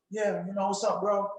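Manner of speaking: low, soft, bored "what's up, bro"; lazy and uninterested; too-cool mumble. Shown as a demonstration. Coach mocking a caller who is cool for school. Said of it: "This ain't time to be cool for school".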